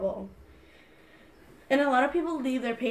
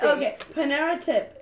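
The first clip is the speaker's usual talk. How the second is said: ordinary delivery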